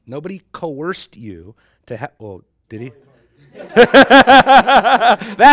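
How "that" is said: loud laughing tease, much higher; big laugh teasing the student. Real, not acted. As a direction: crack up while ribbing him. Teasing Wally's wife, laughing.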